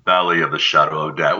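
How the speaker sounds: very low, soft movie-trailer rumble; trailer-narrator bass on "Valley"; deep bass voice. Shown as a demonstration. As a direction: sink "Valley of the shadow of debt" into deep bass. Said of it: "You gotta have some bass".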